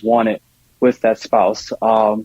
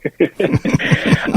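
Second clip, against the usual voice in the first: laugh; 6 semitones higher than usual. Real, not acted. Laughs at the question on conflict.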